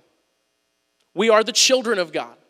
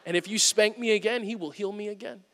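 put-on much softer, hushed little boy; quiet child voice; 11 dB quieter than usual. Son Liam telling his dad, hushed. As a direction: say it like a confident whisper from a kid. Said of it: "He said".

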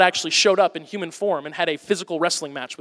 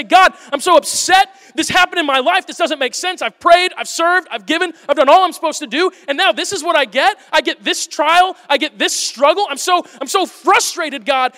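higher, louder, frustrated prayer to God; angry prayer; 9 semitones higher, 9 dB louder than usual. Retold or imitated. Preacher voicing a believer venting at God, upset. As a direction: pour out frustration at God, half-acted. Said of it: "go to God angry ... and say".

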